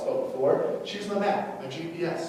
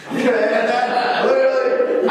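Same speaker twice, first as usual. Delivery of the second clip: loud, high and laughing; real laughter breaking into the story; 8 semitones higher, 7 dB louder, much slower than usual. Real, not acted. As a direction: burst out laughing mid-story. Laughing while recalling crying.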